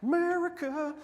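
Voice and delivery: very high announcer call of America; proud announcer. Retold or imitated. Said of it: "So I'm like".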